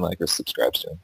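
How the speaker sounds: usual speaking voice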